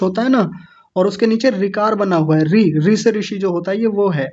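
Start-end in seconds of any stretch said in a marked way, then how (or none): none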